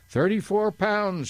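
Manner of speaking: high, drawn-out bird shriek. A narrator's reading. Bird calling back as it flies into the fog, high narrated shriek. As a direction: stretch it out high, mildly shrieked. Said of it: "shrieked the bird".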